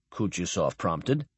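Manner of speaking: ordinary delivery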